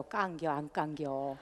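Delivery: slow, soft drawn-out Chungcheong dialect. Shown as a demonstration. Host demos Chungcheong dialect to the audience, slow and soft. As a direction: say it the lazy Chungcheong way, softly. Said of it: "충청도 말로".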